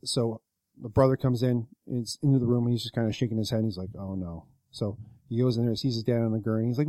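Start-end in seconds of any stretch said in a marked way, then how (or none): 4.0-4.5 low, soft retold dismay; subdued dismay. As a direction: drop it quietly, like breaking bad news. Brother shaking head at bad news.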